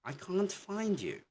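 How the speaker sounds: high-pitched, calling for a lost person; someone searching. Acted. Teacher plays somebody looking for you, high searching voice. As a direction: act out asking where someone is, pitched right up. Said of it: "if somebody asks you".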